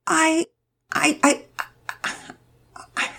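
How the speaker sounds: trailing, breathless stammer; Penelope stuck before her father; faltering stammer. A narrator's reading. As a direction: grope for words you can't find. Child stammering, no answer.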